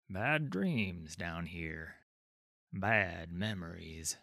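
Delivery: put-on slow, low old warrior warning; lightly voiced weary old soldier, slow; low gravelly dry calm. Old warrior warns Sigvar.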